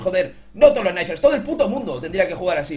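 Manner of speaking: normal talking voice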